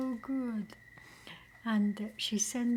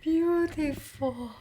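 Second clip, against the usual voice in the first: awed; 6 semitones higher, 5 dB louder than usual. Real, not acted. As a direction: let the word swell with awe. Moved by the gift.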